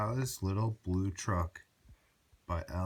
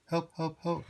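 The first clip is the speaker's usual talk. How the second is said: pleading high calls for help; crying for help; 8 semitones higher than usual. A narrator's reading. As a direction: call out for help like a stuck little truck. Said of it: "cried Little Blue Truck".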